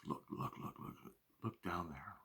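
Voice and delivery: hushed, urgent whisper. Retold or imitated. Whispering to a friend while sneaking up on a bear, urgent whisper. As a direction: point it out under your breath so the bear won't hear.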